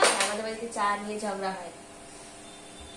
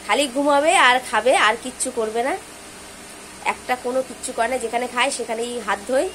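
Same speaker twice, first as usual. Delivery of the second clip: loud teasing complaint; 9 dB louder than usual. Real, not acted. Mother loudly complains about sleeping daughter.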